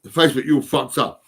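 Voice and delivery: loud, frustrated yelling at the app; angry rant at Facebook. Real, not acted. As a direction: shout your fury at a broken website. Yelling at Facebook for not saving.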